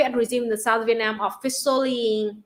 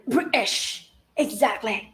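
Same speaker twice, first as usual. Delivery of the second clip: put-on British accent; slower than usual. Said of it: "work on your British accent".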